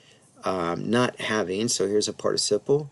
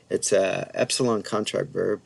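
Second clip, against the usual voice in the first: ordinary talk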